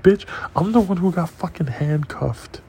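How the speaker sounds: high-pitched retold outrage; indignant comeback. Acts out indignant reply to girlfriend.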